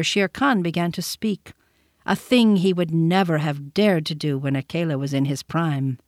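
ordinary delivery, nothing marked

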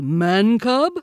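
high, loud bear reprimand; loud, stern rebuke; severe scolding. A narrator's reading. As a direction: scold the pupil like an offended teacher. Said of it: "Baloo said severely".

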